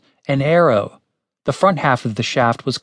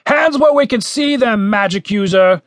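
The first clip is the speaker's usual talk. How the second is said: high, carrying command; commander's shouted order; 8 semitones higher, 3 dB louder than usual. A narrator's reading. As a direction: call out orders from outside. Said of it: "said the voice from outside".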